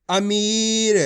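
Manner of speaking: अमीर with the ee of मी held long; drawn-out reading. Shown as a demonstration. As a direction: stretch the middle of अमीर for learners. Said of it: "खींच खींच के पढ़ना है".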